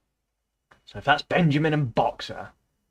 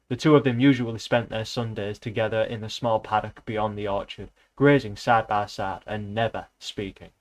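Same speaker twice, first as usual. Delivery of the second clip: reading a novel aloud, even and steady; lightly voiced book reading, just telling the tale; story narration; 2 semitones lower than usual. Reading Animal Farm aloud to viewers, in an even storytelling voice.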